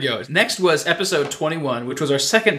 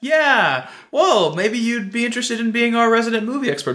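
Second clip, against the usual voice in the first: coaxing invitation; 9 semitones higher than usual. Retold or imitated. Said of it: "I said".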